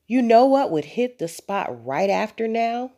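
put-on high, lilting child's question; spring the idea on them keenly; bright-idea girl, pitched well up; eager suggestion. A narrator's reading. Elizabeth springs her next pet idea.

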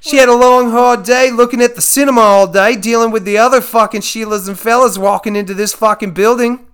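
loud, high-pitched Australian showman; excited Aussie nature host presenting a wild catch; Steve Irwin. Acted. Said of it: "Steve Irwin curses a lot in my fantasy".

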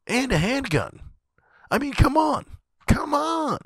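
high incredulous exasperation. Acted. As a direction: squeak out the disbelief. Incredulous punchline.

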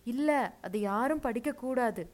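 high, loud, anguished refusal; crying out. A narrator's reading. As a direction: wail it as a desperate plea. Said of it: "என்ன சித்தி அழுதாள்".

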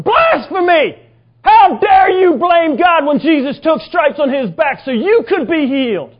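high, full-force preacher's shout; retold angry yell. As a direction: thunder it as God's furious rebuke. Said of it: "he yelled at me about blasphemy".

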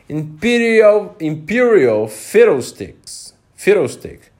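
high, loud, slow indignant king voice; put-on voice of a peeved king; grumpy king. A narrator's reading. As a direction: scoff it out like an offended little king. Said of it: "said the king".

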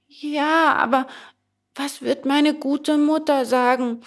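put-on high, worried child voice; hesitant boy. A narrator's reading. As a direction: waver in a small boy's high voice. Hesitant boy worries about mother. (hohe Kinderstimme, zögernd)

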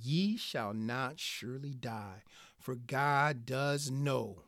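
low, hushed, slow serpent voice; serpent tempting the woman. Acted. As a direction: draw it out softly and low, like a sly tempter. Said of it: "the serpent said to the woman".

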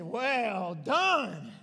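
loud, drawn-out acclaim; booming praise. Acted. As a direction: proclaim the praise loudly, stretching each word. Jesus praising Peter, voiced by preacher.

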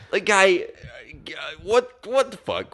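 high-pitched incredulous sputtering; baffled, comic exasperation; exasperated. Real, not acted. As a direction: despair at a character's stupidity. His reaction to weeping Billy.

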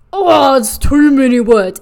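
loud, put-on groan of complaint; mock whining. Student giving up on a dictionary definition, mock whining. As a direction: whine it loudly, giving up at once.

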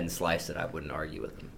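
a breathy whispered aside; whispering. Real, not acted. Quiet aside about sliced baguettes.

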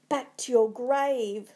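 loud, slow anguished cry; sobbing. A narrator's reading. Macbeth banishing Banquo's ghost, sobbing out loud. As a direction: drive it away with drawn-out dread. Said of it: "sobbed Macbeth".

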